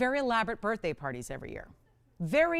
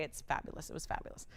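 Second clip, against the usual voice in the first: whispering; 5 semitones lower, 10 dB quieter, faster than usual. Real, not acted. Fond memory of dog's party.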